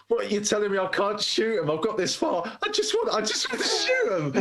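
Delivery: rising to a high-pitched pleading whine; hammed-up protest, building in pitch; mock exasperated captain. Voices the frustrated police captain protesting.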